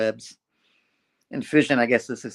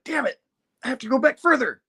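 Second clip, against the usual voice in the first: much higher, annoyed outburst; frustrated; 14 semitones higher than usual. Retold or imitated. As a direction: curse at the bad luck, mock. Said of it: "Overtime's like".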